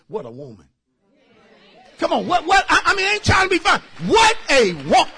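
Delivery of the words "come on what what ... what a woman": high, loud shouting testimony; fiery sermon shout; preaching at full force. Real, not acted. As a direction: whoop it up for the congregation.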